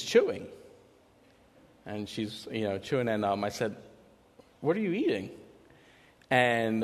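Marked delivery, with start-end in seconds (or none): 4.6-5.3 higher, light, curious parent voice; gentle question. Retold or imitated. Dad asking his chewing daughter, gently higher. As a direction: ask a toddler kindly.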